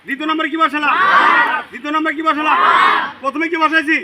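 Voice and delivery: high, loud teacher quizzing; loud drill questioning. Real, not acted. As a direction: press the class for a shouted answer. Rapid question-and-chant drill with pupils. (উঁচু গলায় জোরে পড়া ধরা)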